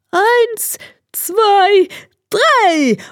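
high, strained hare voice counting down. A narrator's reading. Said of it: "Der Hase zählte". (hoch gepresste Hasenstimme beim Abzählen)

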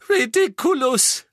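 high, loud sobbing cry of the spell; sobbing harder. Acted. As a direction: push the spell out through heavier sobs. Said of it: "schluchzte sie erneut". (laut und hoch schluchzend, noch verzweifelter)

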